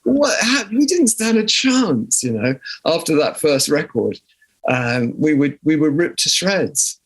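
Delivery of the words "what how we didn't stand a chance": a much higher burst of "What?", slowing into disbelief; exasperated disbelief. Real, not acted. Musician recalling how the press wrecked his band, exasperated disbelief. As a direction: throw up your hands, baffled it ever happened.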